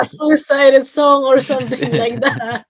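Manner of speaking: real amusement bubbling over mid-sentence; laughing. Real, not acted. Student teasing teacher about music class picks, laughing.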